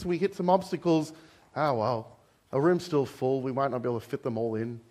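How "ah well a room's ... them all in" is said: lightly voiced lazy churchgoer, quiet and offhand; complacent shrug. Preacher mocking churchgoers' give-up attitude, mild. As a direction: toss it off like it doesn't matter. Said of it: "the attitude".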